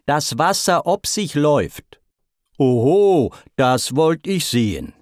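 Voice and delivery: mild storyteller's crow; crowing loudly. A narrator's reading. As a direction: pipe up like a cheeky boy. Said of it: "begann das Büblein überlaut zu krähen".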